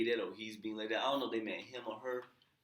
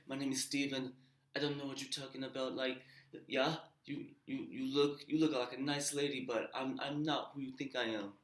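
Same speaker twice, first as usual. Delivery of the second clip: higher-pitched imitation London accent; mock Londoner voice, raised in pitch; 5 semitones higher than usual. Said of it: "the London accent".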